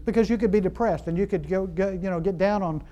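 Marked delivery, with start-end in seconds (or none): none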